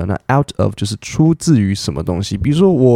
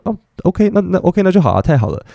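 delighted agreement; 9 semitones higher than usual. Retold or imitated. Taiwanese person happily agreeing.

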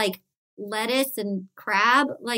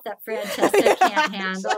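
usual speaking voice

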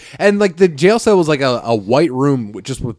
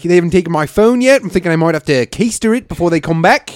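higher, louder New Zealand impression; mock New Zealand accent, pitched up; 6 semitones higher, 4 dB louder than usual. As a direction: retell it as a chatty New Zealand lad. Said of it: "We got a New Zealand boy".

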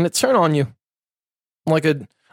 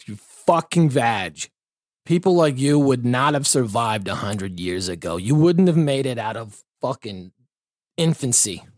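ordinary talk, nothing marked

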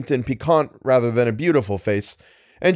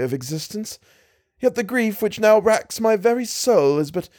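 put-on a higher, pleading young woman's voice; young lady beseeching a stranger, higher and imploring; distressed young lady; 6 semitones higher than usual. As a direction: voice the lady in earnest distress, pitched up.

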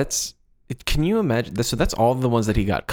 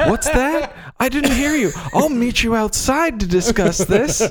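high, laughing mock phone voice; hammed-up fake phone call excuse; 10 semitones higher than usual. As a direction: pretend to take a call to dodge the bill.

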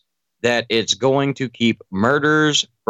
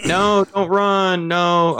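calling out; 8 semitones higher, much slower than usual. Pretend. Officer calling after fleeing suspect.

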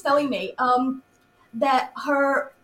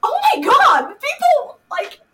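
overwhelmed squeal; 13 semitones higher, 9 dB louder, slower than usual. Retold or imitated. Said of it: "And I'm just like".

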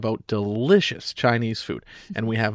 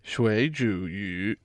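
slow and softer, trying "水煮鱼"; slow soft repeat; 3 semitones lower, 5 dB quieter, much slower than usual. Shown as a demonstration. Learner trying the new word, slow and soft. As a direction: try out "水煮鱼" carefully. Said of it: "water-boiled fish?".

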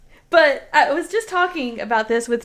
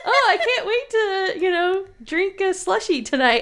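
high-pitched mock gushing; giddy excitement; 9 semitones higher than usual. Mocking her own joy over small treats, giddy and excited. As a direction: gush as if over a huge treat. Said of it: "I don't get as excited about major things".

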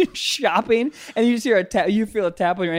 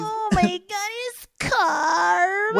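moaning; 10 semitones higher, much slower than usual. Retold or imitated. Mocking moaning clip.

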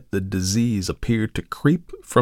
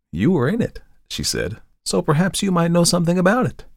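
put-on teasing young woman; 9 semitones higher than usual. Model teasing.

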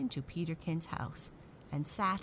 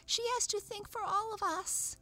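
tiny doll's very high squeak; squeaky voice. A narrator's reading. Penny doll defending Raggedy Ann, high and squeaky. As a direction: squeak it high, like a little toy. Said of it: "cried the little penny doll in a squeaky voice".

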